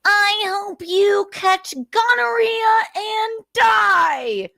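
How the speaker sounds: high, very slow, spiteful mock voice; mocking Nick. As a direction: drag out the insult in a whiny sneer. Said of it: "he tells the officer".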